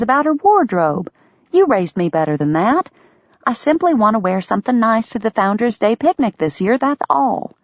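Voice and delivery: coaxing, a touch indignant; Delia, spirited sister. A narrator's reading. Sister Delia voiced in story.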